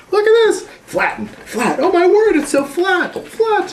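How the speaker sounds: high, bright delight over the Play-Doh. Real, not acted. Excited play with child.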